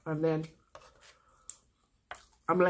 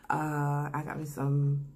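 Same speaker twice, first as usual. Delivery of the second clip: ordinary talk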